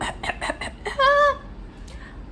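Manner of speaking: high, breathless pained laugh; laughing through the spice pain. Real, not acted. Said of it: "I'm sweating".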